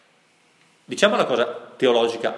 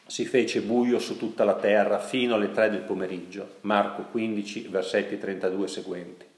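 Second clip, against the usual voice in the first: ordinary delivery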